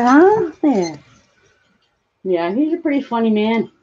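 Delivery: ordinary delivery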